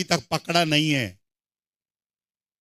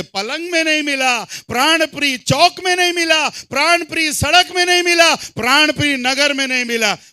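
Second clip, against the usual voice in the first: high shouted refrain, each phrase hammered; impassioned preaching; 7 semitones higher, 5 dB louder than usual. Real, not acted. Preacher recounts the bride's fruitless search, impassioned preaching. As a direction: build a rousing list at full voice.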